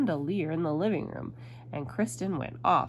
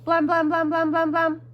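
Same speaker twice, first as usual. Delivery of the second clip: loud, high, rapid-fire knocking noise; booming repeated knocks on one high note; banging knock; 10 semitones higher, 10 dB louder than usual. A narrator's reading. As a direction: pound on the door with the voice. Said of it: "knocked on the door".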